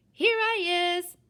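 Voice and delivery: high, loud, drawn-out call; loud childlike announcement. A narrator's reading. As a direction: sing out your hiding place. Invisible child calling out to mother.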